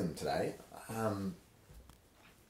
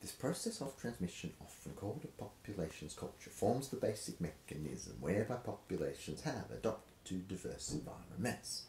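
hushed reading; 6 dB quieter than usual. Shown as a demonstration. Hushed stretch of dramatic reading.

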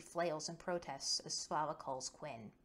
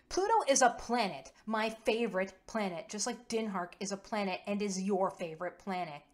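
loud, high, indignant correction; set off; 3 semitones higher, 9 dB louder than usual. A narrator's reading. Quinn, riled up about Pluto, protests loudly to Kova. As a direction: burst out loudly, easing slightly by the end. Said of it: "Quinn set off immediately".

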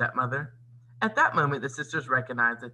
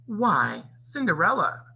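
mock-surprised sneer, rising on the name; 6 semitones higher, 4 dB louder, slower than usual. A narrator's reading. Stepmother taunts Cinderella before the ball, sneering.

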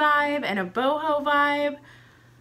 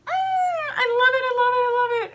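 high squeal, much louder; squealing delight; 11 semitones higher, 6 dB louder, much faster than usual. Real, not acted. Owl perched on her neck, squealing with delight. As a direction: gush like a thrilled fan.

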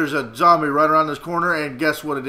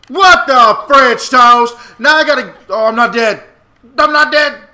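surprised; 7 semitones higher, 12 dB louder than usual. Real, not acted. Surprised in game.

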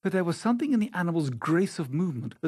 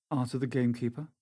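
ordinary delivery, nothing marked

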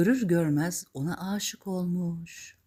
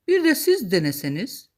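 louder, higher princely voice; raised, courtly request; prince's invitation; 6 semitones higher, 8 dB louder than usual. Acted. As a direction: invite her as a storybook prince. Prince invites Cinderella to try.

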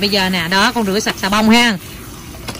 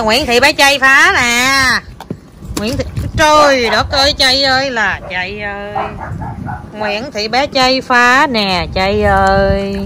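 slow, drawn-out full-name scolding; mock exasperation; slower than usual. Real, not acted. Mom calling out her mischievous child, drawn-out mock exasperation. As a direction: stretch out the name in playful despair.

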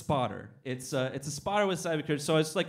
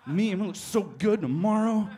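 mock rant; 3 semitones higher, 3 dB louder than usual. Ranting at the machine.

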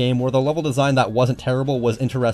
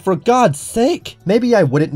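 slow, high, drawn-out groan of anger; fed-up cry; exasperated outburst; 9 semitones higher, 4 dB louder, much slower than usual. Real, not acted. Ranting about the removed E-Tanks.

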